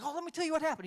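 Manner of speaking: high, loud eager burst; super excited student. Retold or imitated. Student eager to tell teacher what happened, excited. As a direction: gush like a kid with a story. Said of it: "excited to tell me what happened".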